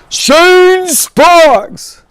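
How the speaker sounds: high, loud shout of a name across the room; loud call. Retold or imitated. Randy Jackson calling out to the choreographer, loud shout. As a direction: yell the name out big. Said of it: "calling my name out so loud like that".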